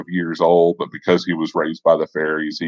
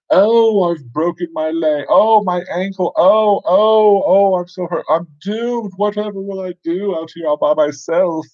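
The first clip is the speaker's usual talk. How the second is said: very high, hammed-up wailing of pain; faking a wound; 12 semitones higher, 3 dB louder than usual. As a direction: badly overact a helpless injured victim. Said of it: "act like you're wounded".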